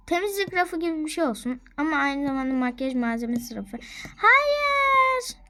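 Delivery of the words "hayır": high squeal. Acted. Refusing a shelf item.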